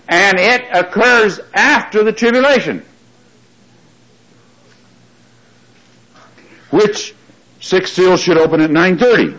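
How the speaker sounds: high-pitched, emphatic pulpit delivery; fervent preaching. Real, not acted. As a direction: preach it up to the congregation, driving each point home. Preacher builds to full force.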